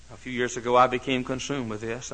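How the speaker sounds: ordinary talk, nothing marked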